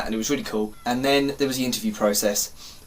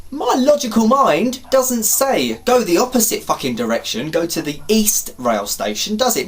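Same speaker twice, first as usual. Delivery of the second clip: hammed-up indignant reasoning; exasperated sarcasm; 7 semitones higher, 3 dB louder than usual. Storyteller mocking the idea of going the wrong way, exasperated.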